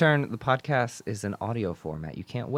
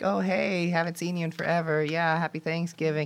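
higher-pitched, perfunctory small talk; mock polite greeting; 5 semitones higher than usual. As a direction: rattle off fake pleasantries to relatives. Acts out routine greeting to relatives.

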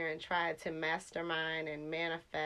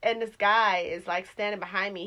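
ordinary talk, nothing marked

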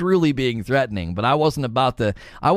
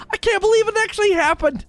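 much higher mock astonishment; hammed-up gasping disbelief, pitched way up; surprised Pikachu face; faster than usual. Player gets hit as expected, cartoonish mock astonishment. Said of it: "surprised Pikachu face".

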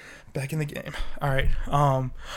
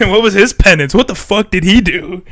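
loud, incredulous mock questioning; loud mock demand; 4 semitones higher, 12 dB louder than usual. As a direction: demand an answer, half laughing. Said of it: "You're like".

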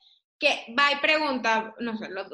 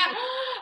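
mock gasp; 10 semitones higher, 4 dB quieter than usual. Mom's embarrassed gasp.

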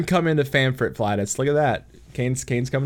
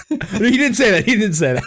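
higher, louder laughing retraction; 10 semitones higher, 4 dB louder, faster than usual. Real, not acted. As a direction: take back the joke while laughing. Laughing after own fake quote.

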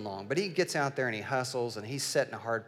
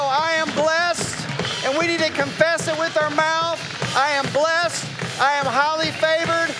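much higher, much louder, ringing pulpit proclamation; preaching at full force; 11 semitones higher, 8 dB louder than usual. Acted. Pastor rousing the congregation to claim blessing, full-force preaching. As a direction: build the congregation into bold declaration.

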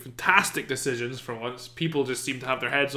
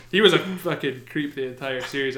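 usual speaking voice

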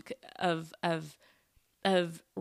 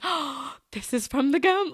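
sudden realization; 7 semitones higher, 4 dB louder than usual. Retold or imitated. Realizing the gum caused sass.

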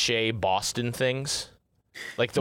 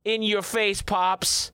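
mock taunt; 9 semitones higher, slower than usual.

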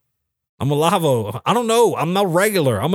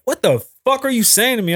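retold outburst; 9 semitones higher than usual. His own retold outburst.

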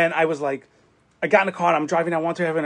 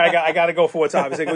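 usual speaking voice

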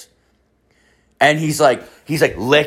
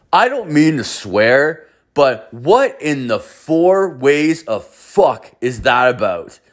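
imitated appalled disbelief; 2 semitones higher, slower than usual. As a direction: act out a stunned bystander, stretching the words. Retold appalled bystander reacting to dad.

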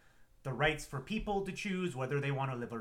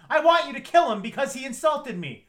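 high, loud spoiled demand; a whiny, entitled order; 10 semitones higher, 11 dB louder than usual. Retold or imitated. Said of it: "goes up to her son figure and goes".

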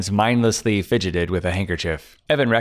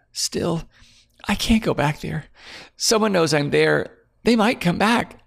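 higher, tearful frightened woman pleading; tearful fear; 9 semitones higher than usual. Acted. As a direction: hold back tears as it spills out. Claire pleading, scared.

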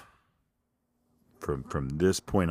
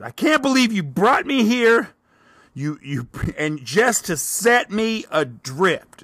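high, loud, slow theatrical cry; dramatic lament; 10 dB louder, slower than usual. Retold or imitated. Scolding the voice for bringing him there, loud hammed-up wail. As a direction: overplay the betrayal grandly. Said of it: "audibly arguing with this voice".

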